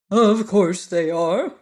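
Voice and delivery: lightly voiced pompous bug; Woggle Bug voice. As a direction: puff up like a know-it-all bug. Woggle Bug's voice.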